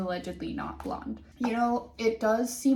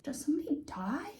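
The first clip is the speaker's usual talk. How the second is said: whispered mock alarm; 6 semitones higher, 4 dB quieter than usual. Real, not acted.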